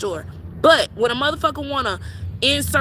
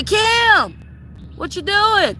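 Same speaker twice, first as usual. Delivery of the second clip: mock call across yard; 7 semitones higher, much slower than usual. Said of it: "And then I'ma say".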